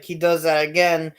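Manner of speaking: ordinary talk, nothing marked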